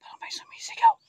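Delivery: hushed whisper to viewers; tense whisper. Real, not acted. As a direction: whisper so nothing hears you. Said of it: "dinig nyo, mga idol?".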